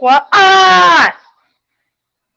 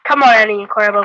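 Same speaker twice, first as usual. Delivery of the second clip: normal talking voice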